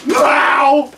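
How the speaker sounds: excited wow. Retold or imitated. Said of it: "It's like".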